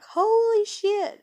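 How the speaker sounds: drawn-out, high, loud holy shit; astonished. Retold or imitated. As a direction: gasp it out in slow amazement. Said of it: "I was like".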